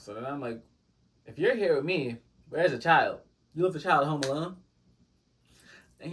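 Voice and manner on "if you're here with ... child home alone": loud, sharp disbelief at his parenting; outraged question. Retold or imitated. Confronting the date about his child, loud and shocked. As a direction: call him out loudly on leaving his kid.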